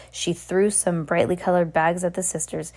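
usual speaking voice

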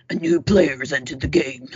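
low mock announcer line; game announcer voice. Host does a Halo game announcer in a low voice. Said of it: "I just made a Halo reference".

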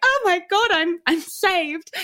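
high, loud, drawn-out exclamation; hammed-up cry of being rescued; mock rapture. Listener overwhelmed by simple advice, mock rapture.

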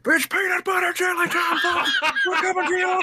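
high laughing hype voice; announcer shout. Pretend.

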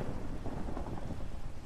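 a long unvoiced sigh of relief; long weary sigh. A narrator's reading. Friend sighs, finding him alive again, long weary sigh. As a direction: breathe out slowly, worn out. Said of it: "he said with a long sigh".

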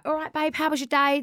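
high, chirpy Essex lad on the phone; a breezy Essex "all right, babe"; Essex accent. Retold or imitated. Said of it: "I sound very Essex".

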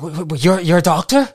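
officer can't believe a doctor is here; surprised officer. Acted. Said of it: "He's like".

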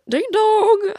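high sung ding dong chime; doorbell imitation.

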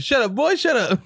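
high mock scolding mom. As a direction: hush him like an annoyed mother. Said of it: "She's like".

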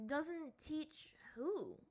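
high, drawn-out anxious question; worried. A narrator's reading. Aang asking who won't be taught, worried. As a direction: stretch the question upward with worry.

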